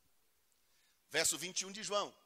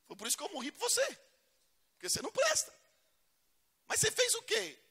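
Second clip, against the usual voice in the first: God's voice; 3 dB louder than usual. Retold or imitated. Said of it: "Deus diz".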